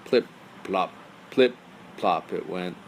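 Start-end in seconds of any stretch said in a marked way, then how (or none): none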